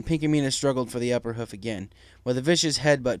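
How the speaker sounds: plain reading voice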